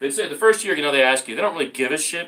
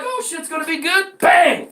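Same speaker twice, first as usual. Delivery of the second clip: mock hope then bang; 14 semitones higher, 7 dB louder than usual.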